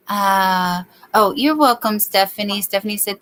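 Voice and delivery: ordinary delivery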